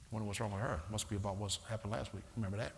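low, soft, fast sly murmur; fast devil whisper. Retold or imitated. Preacher voices the devil's quick soft gossip. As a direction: slip in the gossip softly and quickly. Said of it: "The devil's a fast talker".

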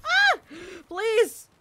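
very high, loud yelp and beg; shriek, then plead for mercy; panicked plea. Pretend. Player begging attacking enemies, panicked plea.

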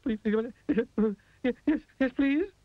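very high, shaking rapid-fire stammer; quivering. A narrator's reading. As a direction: tremble all over while begging. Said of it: "quivered and shook and said".

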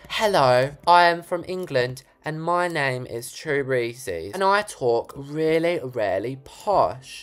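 exaggerated English accent, slow and drawn out; put-on, slowly stretched English accent; mock English accent. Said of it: "Americans try to do an English accent".

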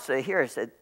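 ordinary talk

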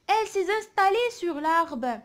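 very slow, projected reading; poem read aloud. Shown as a demonstration. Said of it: "نقراو الشعر هذا مع بعضنا".

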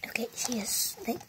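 quick secret whisper; hushed aside to camera. Real, not acted. Whispered aside about sister.

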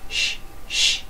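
quiet whispered shh shh; whispered shush. Shown as a demonstration. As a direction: hush like falling snow.

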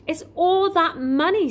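high, upset wail, not sobbing hard; through tears. A narrator's reading. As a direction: lament it, close to tears. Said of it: "barely inaudible through the tears".

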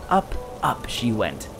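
usual speaking voice

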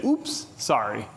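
drawn-out little apology after bumping someone; low, unhurried polite sorry; Minnesotan apology. Shown as a demonstration. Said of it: "you say".

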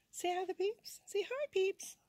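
high cooing voice for the cat. Real, not acted. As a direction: coo at the cat, sweet and high. Coaxing cat in baby voice.

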